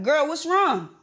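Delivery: retold worried plea to a friend; urgent worried ask. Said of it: "So I'm like".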